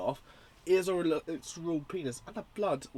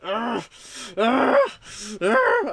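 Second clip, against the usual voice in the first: rising screams of agony, higher and louder each time; pained groans; 10 semitones higher, 9 dB louder, much slower than usual. Acted. Imitating the film's character screaming as he cuts, rising agonised howls. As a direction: howl like a man in pain. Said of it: "well, he's like".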